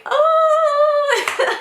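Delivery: a high sung sob of an oh; teary wail. Real, not acted. Wife crying as husband spots the test, wailing oh. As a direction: let the oh break into a crying wail. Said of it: "I just start bawling".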